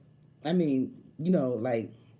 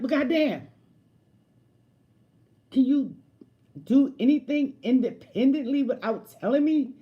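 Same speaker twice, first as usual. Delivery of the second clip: loud, high, fed-up yell; snapping back at a controlling partner, loud and exasperated; exasperated outburst; 8 semitones higher, 7 dB louder than usual. Retold or imitated. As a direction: blow up at him, much higher and louder. Quoting her angry retort.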